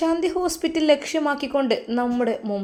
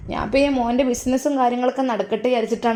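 put-on loud, higher old mother's voice on the phone; mother on the phone to Faisal, loud and higher; affectionate old mother; 4 semitones higher, 7 dB louder than usual. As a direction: greet your son loudly, full of fondness.

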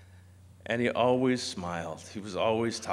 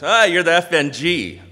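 loud, higher-pitched mock needling; teasing veteran; 6 semitones higher, 10 dB louder than usual. Ken needling the new hire, loud and teasing. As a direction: rib the new guy, loudly.

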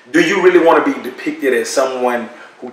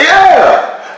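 Suge's voice. Retold or imitated. Suge answers on the phone.

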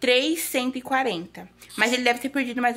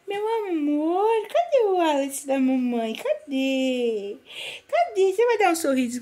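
slow, high, drawn-out cooing; 5 semitones higher than usual. Real, not acted. Mother coaxing her baby to smile, cooing slowly. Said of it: "Ele adora que eu falo assim com ele".

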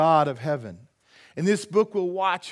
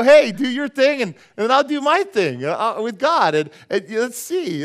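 higher, louder cheeky dare; bold challenge; 6 semitones higher, 7 dB louder than usual. Retold or imitated. Pastor daring the spiritist to do his thing, higher and louder. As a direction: challenge him with bouncy bravado. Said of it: "I said, I said".

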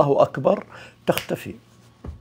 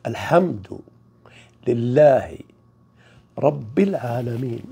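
slow recitation; 2 semitones lower, slower than usual. Shown as a demonstration. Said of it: "ثالث كلمة".

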